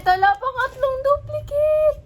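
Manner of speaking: high, loud wail of disbelief; shocked outcry. Real, not acted. Vlogger finding a third duplicate, shocked loud outcry. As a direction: cry out in dismay, pitch way up.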